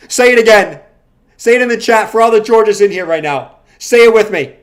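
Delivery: loud, high preacher-style order; hammed-up cult leader barking orders; mock cult command.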